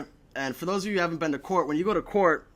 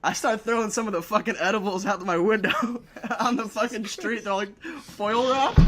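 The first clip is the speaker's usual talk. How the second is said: laughing while telling; 6 semitones higher, 4 dB louder than usual. Real, not acted. Laughing at own edibles story.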